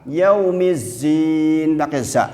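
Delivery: usual speaking voice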